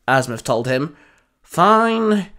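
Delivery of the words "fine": high, drawn-out grudging word; grudging mutter. A narrator's reading. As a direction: give in with audible reluctance. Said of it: "Albedo muttered".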